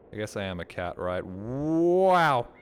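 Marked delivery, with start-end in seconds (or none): 1.3-2.5 excited. Real, not acted. Impressed by cooking.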